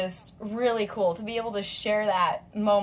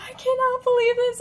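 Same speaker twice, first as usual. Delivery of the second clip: high, overwhelmed disbelief; can't believe the luck; squealing disbelief; 4 dB louder than usual. Retold or imitated. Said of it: "I'm just like".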